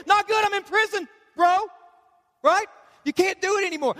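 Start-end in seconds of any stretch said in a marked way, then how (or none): 0.1-1.7 whiny stuck guy. Retold or imitated. Pastor voicing a listener trapped in bad habits. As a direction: complain like a dude who's stuck.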